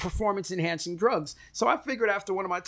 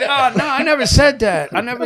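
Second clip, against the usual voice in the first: loud, pitched up, laughing denial; 7 semitones higher, 9 dB louder than usual. Retold or imitated. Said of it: "I said".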